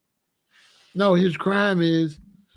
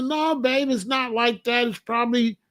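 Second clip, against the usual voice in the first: husband reassuring; 7 semitones higher than usual. Retold or imitated. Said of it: "I'm telling her".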